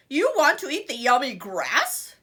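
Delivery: loud mocking troll; troll roar. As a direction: roar it back at the goat. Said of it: "the mean old troll roared".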